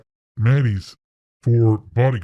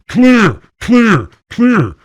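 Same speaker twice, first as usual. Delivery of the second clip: sharp, high, loud calls; loud clipped room-clearing call; shouted clear; 12 semitones higher, 9 dB louder, much slower than usual. Retold or imitated. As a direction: bark it like a soldier sweeping rooms. Bodyguards sweeping house.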